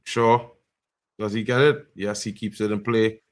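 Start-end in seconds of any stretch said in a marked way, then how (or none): none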